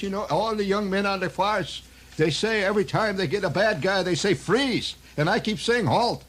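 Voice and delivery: high, put-on Irish brogue of an old cop. Officer O'Leary tells the narrator about the young men, Irish brogue. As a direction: grumble like a beat cop left behind.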